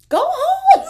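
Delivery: shrieked high and loud; mock yell. At the Nutcracker, shrieking at the children, loud. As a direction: screech it at the kids to go home. Yelling at kids at ballet.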